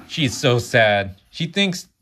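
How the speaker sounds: high and loud, upset for the princess; upset reaction. Real, not acted. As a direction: blurt out sympathy, slowly at first. Reader reacts to sad story.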